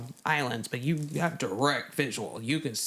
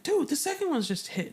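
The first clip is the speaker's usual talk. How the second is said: higher, urgent report to a friend; urgent news; 10 semitones higher than usual. Retold or imitated. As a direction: break the news fast. Shipmates relaying news.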